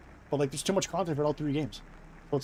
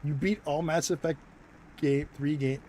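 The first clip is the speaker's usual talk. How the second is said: ordinary talk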